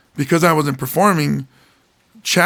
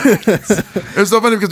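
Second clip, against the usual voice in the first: laughing; 6 semitones higher than usual. Real, not acted. Cat interrupts recording.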